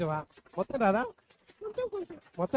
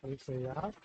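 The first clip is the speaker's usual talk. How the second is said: put-on slow, softer prayer line for the child to repeat; leading a prayer; 7 semitones lower, 7 dB quieter, much slower than usual. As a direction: pray it slowly so the child can follow. Adult leads prayer. (నెమ్మదిగా, మెత్తగా ప్రార్థన)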